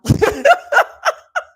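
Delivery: loud high burst of laughter; laughing. Real, not acted. Laughing to viewers about being on TikTok. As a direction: break into real laughter.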